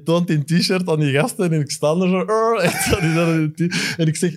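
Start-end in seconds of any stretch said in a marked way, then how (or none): 2.2-2.6 a playful high growl, lightly done; mock pirate growl. Fan growling at stars over his t-shirt, mock growl.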